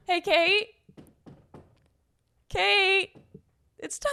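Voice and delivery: sweet, drawn-out, overly nice calling of a name; imitating Pape's overly nice voice. Retelling a coworker's polite knock, overly nice mimicry. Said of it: "This is how he says it".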